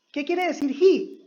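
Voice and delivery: usual speaking voice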